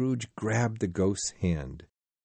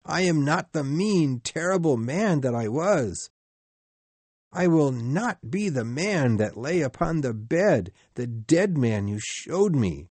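desperate plea; 6 semitones higher, 3 dB louder than usual. Acted. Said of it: "he cried".

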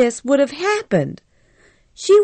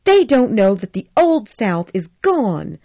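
put-on higher, indignant young woman, mildly angry; angry Scarlett; 7 semitones higher, 3 dB louder than usual. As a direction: Scarlett defending herself with a touch of anger. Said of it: "said Scarlett angrily".

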